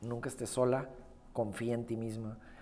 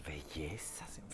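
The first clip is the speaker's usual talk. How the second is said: whisper; 10 semitones lower, 10 dB quieter, much slower than usual. Real, not acted. Echoes song title softly.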